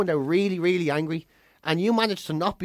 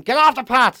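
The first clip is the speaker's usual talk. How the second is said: imitated angry roar, raised and loud; roaring Garda; 5 semitones higher, 4 dB louder than usual. Garda bawling at a motorcyclist parked on the path, roaring. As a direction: roar it like an irate policeman. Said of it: "he starts roaring".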